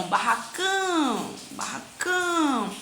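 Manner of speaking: "barracão" with a long, stressed final "cão"; stressed syllable. Shown as a demonstration. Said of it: "sílaba pronunciada com mais intensidade". (sílaba tônica alongada no "cão" de "barracão")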